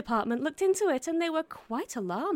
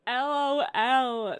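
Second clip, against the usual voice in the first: spelled-out LOL; 3 dB louder, much slower than usual. Acted. Reading out a text slowly.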